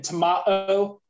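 tomato as "tuh-MAH-to", drawn out; British tomato. Retold or imitated. Recalling Uncle Paul's words, tomato with British "ah". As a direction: say tomato slowly with an "ah". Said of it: "say with a British accent".

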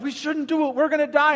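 a hint of fright; scared tough guys. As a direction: whimper it like the fearful spies. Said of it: "These big, tough guys were like".

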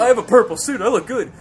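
loud, smug mimicry of friend; mocking imitation. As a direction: parrot him back, puffed up and dumb. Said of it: "mocking S0's claim".

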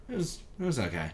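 flat, muted "it was okay"; hushed, fast, deflated shrug; underwhelmed. Retold or imitated. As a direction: say it like the show meant nothing. Deflated after a great show.

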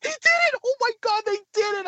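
imitating an overjoyed wife, very high; sky-high mock squeal of joy; ecstatic celebration. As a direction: squeal it like a thrilled fan. Voices wife celebrating the goal.

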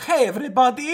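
high laughing pope voice; mock pope greeting. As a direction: beam it out like the pope. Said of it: "he came out and said".

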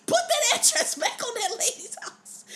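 high squealed imitation breaking into laughter; mock angry order. Storyteller as dad ordering the thief to return it, laughing mock anger. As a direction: play the furious dad while cracking up.

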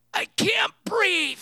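high, loud, drawn-out mock wail; hammed-up desperate yell, slow and high; choking outcry. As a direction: cry out as if suffocating in a stall. Trapped stall neighbor cries out, retold.

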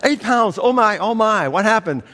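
put-on high, shocked old mother on the phone; shocked grandma. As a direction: clutch your pearls as grandma. Grandma voiced in phone story.

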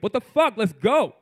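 loud, high impatient burst; nineteen-year-old brain yelling. Acted. Young man's brain urging him on mid-hookup, loud impatient yell. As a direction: shout it like an impatient teenage mind. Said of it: "in my nineteen-year-old brain".

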